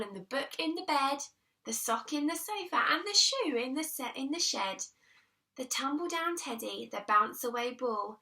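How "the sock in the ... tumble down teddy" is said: usual speaking voice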